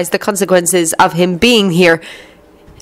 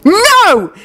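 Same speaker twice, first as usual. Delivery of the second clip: high-pitched yelled protest; 4 dB louder than usual. A narrator's reading. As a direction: yell it out in desperation. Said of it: "he yelled".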